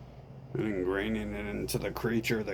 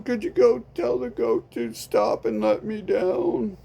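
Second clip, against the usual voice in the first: high pitched pleading victim voice, louder; high whiny victim; 13 semitones higher, 6 dB louder than usual. Acted. Victim begging a friend to stop the goat, high pleading. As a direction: beg someone to make it stop.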